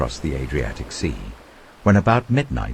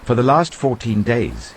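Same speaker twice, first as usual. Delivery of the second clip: higher, louder earnest urging; 7 semitones higher, 5 dB louder than usual. Acted. Paul urging the starving crew to eat, earnest urging. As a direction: press them hard to listen. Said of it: "Paul urged them all to eat".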